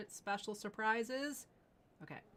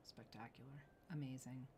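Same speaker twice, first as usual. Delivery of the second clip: hushed awe; 5 semitones lower, 10 dB quieter than usual. Real, not acted. Host admiring final ring.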